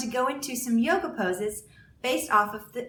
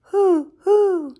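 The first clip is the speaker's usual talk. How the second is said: higher, louder owl hoots; 8 semitones higher, 11 dB louder than usual. Acted. A teacher voicing Owl in a story, hooting. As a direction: hoot like an owl calling. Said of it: "called Owl".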